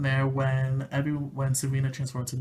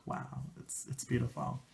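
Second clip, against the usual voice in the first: awed whisper; 10 dB quieter than usual. Retold or imitated. Awed reaction to song.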